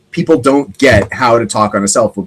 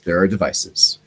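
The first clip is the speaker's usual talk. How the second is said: hushed confiding; 5 semitones lower, 6 dB quieter than usual. Acted. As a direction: drop to a low, soft conspiratorial voice. Hushed repeat after "Oh yes.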